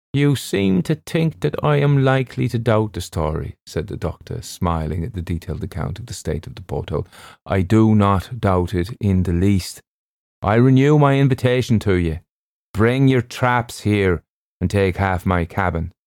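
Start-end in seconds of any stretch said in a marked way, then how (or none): 0.1-3.6 higher-voiced doctor, gently challenging; amused doctor character, pitched up; ship's doctor, amused. 7.4-15.9 measured and hearty doctor voice; ship's doctor, insistent. Acted.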